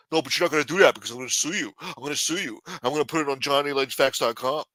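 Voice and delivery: fast, rushed threat to sue; Casperosa threatening. Pretend. Casperosa threatening to sue the councilman, fast rushed voice. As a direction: rattle off the threat quickly. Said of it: "Casperosa's gonna be popping out".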